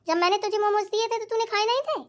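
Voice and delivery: usual speaking voice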